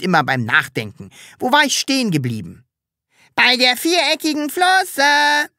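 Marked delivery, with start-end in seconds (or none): 3.3-5.5 put-on high, slow, called-out children's chorus; children calling out. A narrator's reading. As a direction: call it out together like kids. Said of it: "riefen die Kinder". (rufende Kinder, hoch und langsam)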